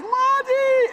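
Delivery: a high, loud call of the name; yelling. Retold or imitated. Boys searching for their friend, high loud yell. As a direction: holler the name up the bank. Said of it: "And they're yelling".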